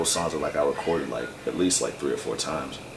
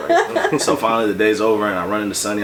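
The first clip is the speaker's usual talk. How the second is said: ordinary talk, nothing marked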